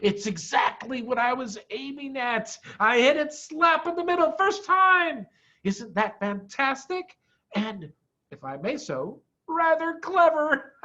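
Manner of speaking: high, pleased bragging; a little preen at his own cleverness; proud chuckling boast; smug boast. A narrator's reading. Fox boasts to cubs.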